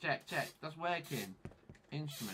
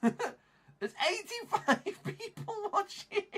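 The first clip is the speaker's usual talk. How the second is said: high, slow squeal through laughter; laugh-cry the words in disbelief; stretched-out despairing shriek; hysterical wail; 4 dB louder, slower than usual. Real, not acted. Hysterical repeat about audience.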